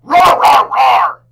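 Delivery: very high loud playful roar; bear roaring. A narrator's reading. Bear stomping down the mountain, roaring loudly.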